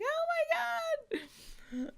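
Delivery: very high, drawn-out squeal; hammed-up squeal of amazement; mock excited American. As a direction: imitate a thrilled American, stretching it out sky-high. Imitating Americans thrilled by London answer.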